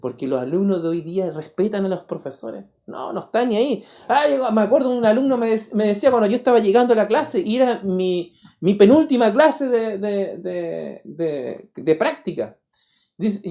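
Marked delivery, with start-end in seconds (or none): none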